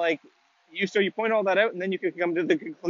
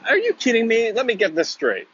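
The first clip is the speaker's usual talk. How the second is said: disbelieving, higher and louder; mock outrage; 6 semitones higher, 5 dB louder than usual. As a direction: act scandalised, throw the question back. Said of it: "mock outrage at Nick's comment".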